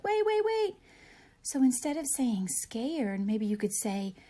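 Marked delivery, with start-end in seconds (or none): 0.0-0.7 high, loud calls after the bus; anxious calling. Retold or imitated. Teacher acting a child left at the bus stop, high anxious calls. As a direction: call out to a bus pulling away. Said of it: "you're like".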